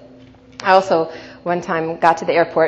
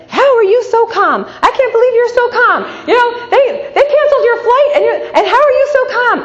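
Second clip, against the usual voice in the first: much higher, louder amazed woman's voice; astonished lady; 7 dB louder than usual. Retold or imitated. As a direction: play the stunned stranger in line. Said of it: "the lady next to me says".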